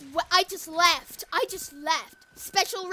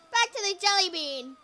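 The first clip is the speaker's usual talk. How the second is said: ordinary talk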